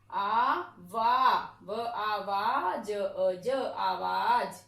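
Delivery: slowly stretched आ वा, each sound held long; drawn-out syllables. Shown as a demonstration. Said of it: "देखिए आ".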